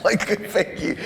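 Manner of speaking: amused, quoting your younger self; laughing. Real, not acted. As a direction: chuckle into the quote. Laughing while quoting himself.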